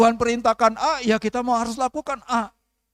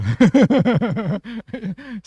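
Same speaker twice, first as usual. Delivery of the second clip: laughing; 5 dB louder than usual. Real, not acted. Laughing at own style.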